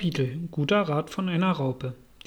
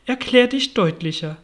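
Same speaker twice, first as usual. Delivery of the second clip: higher, louder stern demand; 6 semitones higher, 5 dB louder than usual. A narrator's reading. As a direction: order it strictly, with a firm push. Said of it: "fragt die Raupe streng". (streng und mit Nachdruck)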